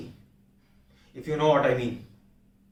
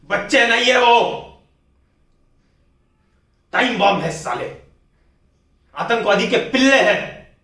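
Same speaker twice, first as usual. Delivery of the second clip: raised, louder voice in anger; angry outburst; 5 semitones higher, 7 dB louder than usual. Acted. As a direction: spit it out as a furious condemnation. Officer's furious outburst about enemies. (गुस्से में ऊँची आवाज़)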